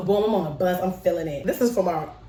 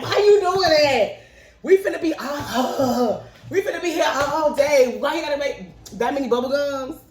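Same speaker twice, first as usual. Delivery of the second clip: put-on exasperated kids; 7 semitones higher than usual. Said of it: "We like".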